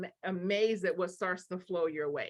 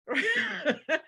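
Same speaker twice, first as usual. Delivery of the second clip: laugh; 9 semitones higher than usual. Real, not acted. Laughs after mock put-down.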